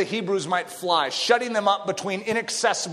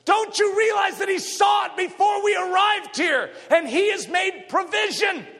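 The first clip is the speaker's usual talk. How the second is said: preaching at full force; 11 semitones higher than usual. Real, not acted. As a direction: thunder it at the congregation. Sermon climax, preacher shouting.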